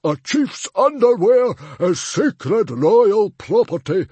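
high, loud, slow bellow; outraged howl. Acted. Chief defending his royal underwear, howling. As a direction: howl it with huge indignation. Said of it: "howled Stoic the Vast".

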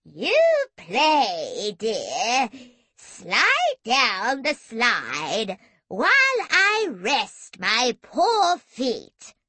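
very slow, loud, swooping granny wail; quavering old granny. A narrator's reading. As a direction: draw each word out in a wobbly old voice. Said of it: "Super Granny quavered placidly".

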